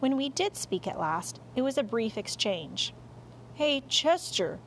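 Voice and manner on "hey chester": higher, drawn-out friendly call. A narrator's reading. Dog hailing the cat under the sofa, calling out. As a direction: call over to a friend in hiding. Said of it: "I called".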